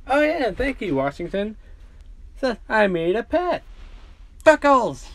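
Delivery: high, eager voice building to a loud call; gush, then holler for a pet; childlike glee pitched high; excited recall. Acted. Caboose excitedly calls his pet.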